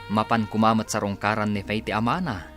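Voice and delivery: ordinary talk, nothing marked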